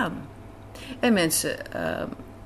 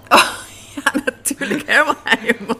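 laughing, louder and higher as the name comes back; delighted laughing recall; 7 semitones higher, 9 dB louder than usual. Real, not acted. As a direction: laugh with relief at remembering.

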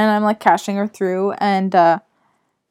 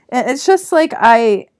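normal talking voice

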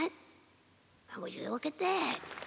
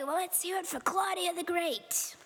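ordinary delivery

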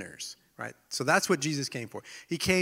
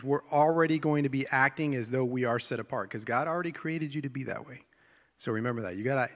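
usual speaking voice

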